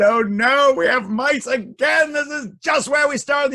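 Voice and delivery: high, loud wailing shout; exasperated king. A narrator's reading. King back where he started with mice, high exasperated cry. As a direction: shout in theatrical despair.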